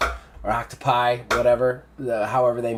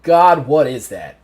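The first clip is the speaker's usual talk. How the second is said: mildly frightened Aubrey, louder on GOD; a little scared; 7 dB louder, slower than usual. Acted. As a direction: act a touch of alarm at a strange sight. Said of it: "Aubrey's a little bit scared".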